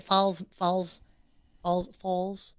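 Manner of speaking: falls with the tongue drawn back; tongue pulled back. Shown as a demonstration. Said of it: "you go back in our tongue".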